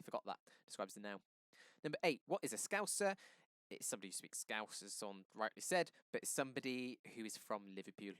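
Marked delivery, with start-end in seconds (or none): none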